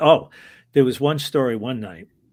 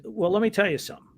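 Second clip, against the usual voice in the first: higher, fast, assured lead-in; quick confident bluff; 9 semitones higher, faster than usual. Retold or imitated. Referee bluffing the drunk fans, a quick, high, confident lead-in. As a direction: talk fast to get out of trouble.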